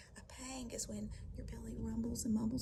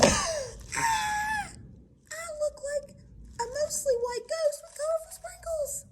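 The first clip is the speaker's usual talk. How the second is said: high, loud panicked wail; hammed-up scream of a shocked little ghost; horrified shriek; 13 dB louder, slower than usual. Ghost horrified by his sprinkles.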